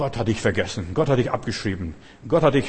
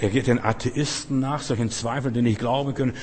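normal talking voice

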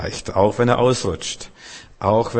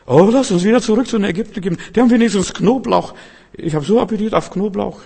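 much higher, louder, fast whining; whining Israelites; 11 semitones higher, 5 dB louder than usual. Retold or imitated. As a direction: grumble for garlic like homesick complainers. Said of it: "ihre Reise abbrechen und umkehren". (hohes, schnelles Quengeln der Israeliten)

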